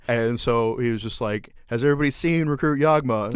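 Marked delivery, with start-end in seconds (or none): 1.7-3.3 raised, higher-pitched drill instructor call. Retold or imitated. Retelling drill instructor questioning recruits, mock loud call. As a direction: call out loudly to a group of recruits.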